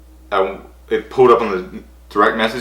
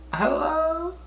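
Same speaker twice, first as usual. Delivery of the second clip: squeaky high hello; 8 dB quieter than usual. Retold or imitated. Answering the call.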